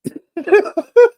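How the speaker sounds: sudden high laugh at the joke; real amused laugh; burst of laughter. Real, not acted. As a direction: laugh out loud, high and bright. Host laughs at mushroom joke.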